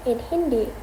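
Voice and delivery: usual speaking voice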